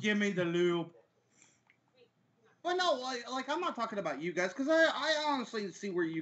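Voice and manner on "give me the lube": mock teenage boy. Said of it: "fourteen-year-old boys jacking off".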